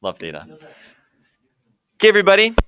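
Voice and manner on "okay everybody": calling the class. Real, not acted. Calls the class to attention.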